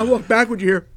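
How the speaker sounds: ordinary talk, nothing marked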